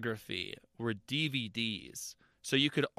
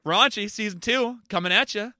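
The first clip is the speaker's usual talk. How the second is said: loud, high teasing announcement; excited teasing shout; 10 semitones higher, 11 dB louder than usual. Acted. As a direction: hype it up with mischief. Teasing raunchy season two.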